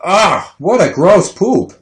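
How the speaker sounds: mock-gagging granddad's disgust. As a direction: recoil from a stinking diaper. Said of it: "opened the diaper and gagged".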